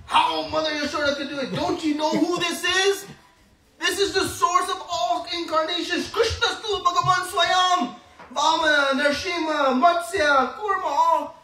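high, loud, proud listing of incarnations; second wind boast. Acted. Balram regains courage and boasts to Yashoda, high and proud. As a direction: puff up and show off his brother. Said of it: "he gets his second wind".

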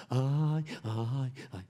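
hammed-up priest crying at a funeral, loud first ay; mock wailing. Shown as a demonstration. Priest pretends to weep at a funeral, mock wailing. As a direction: sob out each ay in mock grief. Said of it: "¿Cómo le parece yo aquí?".

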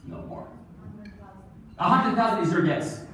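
ordinary talk, nothing marked

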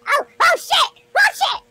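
high-pitched startled panic; freaked out. Real, not acted. Player freaked out and pressed a button, panicked yelp. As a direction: yelp in sudden alarm. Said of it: "I just freaked out".

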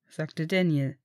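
ordinary delivery, nothing marked

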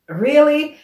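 high-pitched, incredulous question. Retold or imitated. Woman recalling her thought on her stolen data, incredulous. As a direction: question it in offended disbelief. Retold disbelief at data theft.

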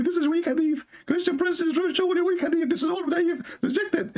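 high, mocking voice of a critic dismissing the hadith; high mock voice of the critics yelling weak hadith; mocking the opponents. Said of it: "they will say to you".

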